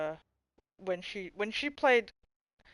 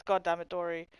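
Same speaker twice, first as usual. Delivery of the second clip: usual speaking voice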